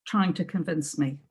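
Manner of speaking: plain reading voice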